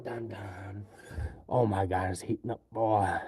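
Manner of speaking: ordinary talk, nothing marked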